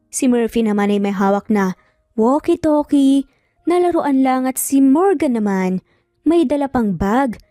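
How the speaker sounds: ordinary delivery, nothing marked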